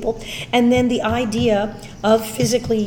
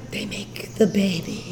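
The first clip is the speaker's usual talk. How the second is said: wry quiet aside; 3 semitones lower, 6 dB quieter, slower than usual. Retold or imitated. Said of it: "And I said".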